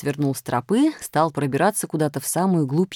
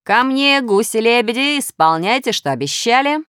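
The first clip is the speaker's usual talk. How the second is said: higher, louder villain's call; commanding storybook cry to a flock; shouted summons; 5 semitones higher, 4 dB louder than usual. A narrator's reading. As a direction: call the magic geese to you. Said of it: "Вдруг Минус остановился и крикнул".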